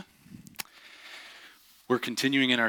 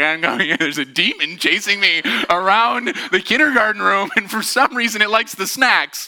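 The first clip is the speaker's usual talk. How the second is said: hint of a frantic kid; 8 semitones higher, 5 dB louder than usual. Child's garbled takeaway.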